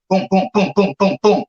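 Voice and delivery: rhythmic booms for landing punches; imitated punches. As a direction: punch out each boom in quick, even beats.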